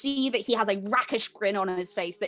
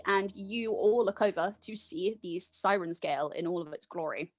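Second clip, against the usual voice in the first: ordinary talk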